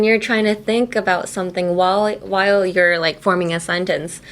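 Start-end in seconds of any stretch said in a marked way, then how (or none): none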